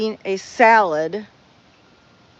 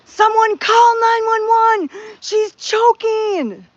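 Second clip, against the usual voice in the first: high, loud, slow shouted plea; frantic cry for help; yelling for help; 12 semitones higher, 5 dB louder, slower than usual. Retold or imitated. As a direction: yell across the restaurant for help. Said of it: "Kathy yells".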